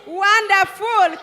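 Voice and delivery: delighted praise. Real, not acted. Praising the teachers' song.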